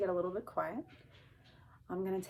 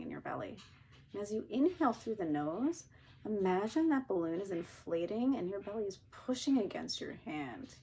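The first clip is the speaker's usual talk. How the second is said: calm grounding exercise. Shown as a demonstration. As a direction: calmly guide the breath. Leads a breathing exercise.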